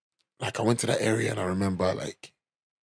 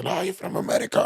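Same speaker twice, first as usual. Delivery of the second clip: mock local insisting; 9 semitones higher than usual. Locals insisting he's American.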